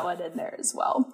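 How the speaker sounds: whispered aside; unvoiced whispered aside, half laughing. Real, not acted. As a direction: whisper it like a guilty confession.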